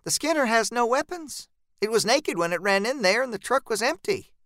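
high, unforced character voice; Jean reassuring. Acted.

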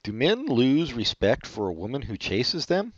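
ordinary talk

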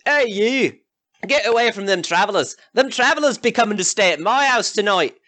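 high, pushy yokel calling out; brash rival villager. Acted. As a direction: shout down a rival host. Rival villager Jockey hails travellers.

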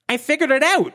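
high smug voice. Acted. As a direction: Velma boasts to the group, smug. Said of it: "she says smugly".

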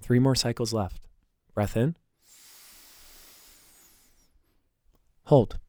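calm, soft breathing cues. Acted. Leads a breathing exercise.

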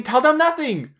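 put-on high, emphatic Filipino-accented order; high-pitched mock Filipino accent. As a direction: imitate a wife forbidding it in a Filipino accent. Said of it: "a Filipino accent because his wife is".

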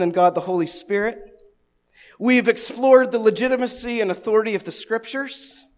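ordinary delivery

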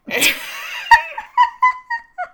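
shrieking laugh, way up high; real high-pitched laugh; high burst of laughter. Real, not acted. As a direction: cackle at your own joke. Laughs at her own joke.

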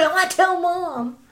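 put-on high, loud kid-style complaint; mock tattling sibling. Voicing a sibling threatening to tell Mom, high mock whine. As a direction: play the aggrieved little sister.

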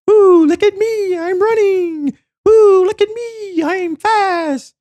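squeaky high mocking voice; mock show-off runner. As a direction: play a smug jogger in a high squeal.

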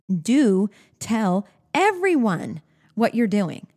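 slow and deliberate, a pause after each word. Shown as a demonstration. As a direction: announce the rule one word at a time. Rule given slowly, then repeated.

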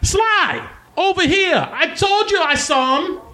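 very high-pitched gnome calling out; screaming out. Acted. Gnome calling Sly over through the crowd, screaming out. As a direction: holler high for a friend, without full volume. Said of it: "she screams out".

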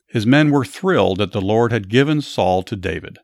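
steady, lively Bible storytelling; story narration. A narrator's reading. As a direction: tell it like a story to a listener.

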